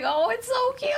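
squealed way up high, delighted; gushing. Retold or imitated. Friend squealing at seeing the merch, high delighted gush. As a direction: gush over it like a fan. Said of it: "I was like".

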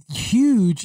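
"huge" imitated as "yooj", no H; quoted mispronunciation of "huge" as "yooj". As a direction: stretch "huge" out with a Y in place of the H. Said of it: "is coming out as huge".

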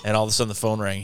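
ordinary delivery

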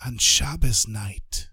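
slow, breathy whisper of a dying rebbe. Acted. Dying rebbe whispering a promise to his student. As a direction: whisper a gentle promise, slowly. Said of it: "smiled at me and whispered".

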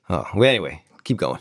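ordinary delivery

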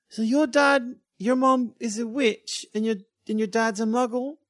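put-on high, loud questioning girl voice; puzzled schoolgirl. Schoolgirl asking a classmate about her parents, high and puzzled.

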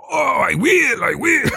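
a big cartoonish shout. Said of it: "You're gonna go".

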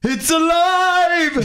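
high, drawn-out theatrical cry of "alive"; mad-scientist cry. Acted. Husband re-enacting the film line, exultant mad-scientist cry. Acting out the quoted movie line.

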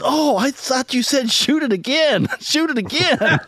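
very high, drawn-out punchline voice; hard-of-hearing grandpa. Acted. Grandpa explains misheard warning to friend, high slow exclaiming.

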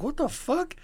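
high, rising incredulous squeak; retold bafflement. Reacting to the snarky waitress, high incredulous question. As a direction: pitch shoots up in mock disbelief.

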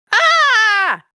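sharp high yelp of fright; startled yelp. Acted. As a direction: yelp in sudden shock. Officer sees werewolf.

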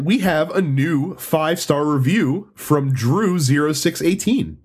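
usual speaking voice

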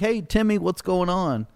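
high, sing-out hail to someone familiar; imitated friendly greeting. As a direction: mimic a cop calling to someone he knows. Retelling cop greeting Timmy.

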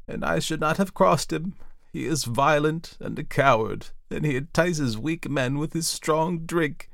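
high, anguished outpouring; fretful aunt working herself up towards tears; distressed, near tears. A narrator's reading. Auntie tells nephew of the brewer's threat, tearfully distressed. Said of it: "She began to cry again".

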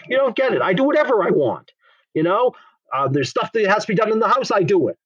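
high, emphatic retold defiance; insistent retort. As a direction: hammer it home, almost exasperated. Woman insisting on doing whatever.

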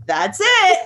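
high-pitched confirming imitation; mock nurse answer. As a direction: answer high, falling at the end. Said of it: "the interaction of the".

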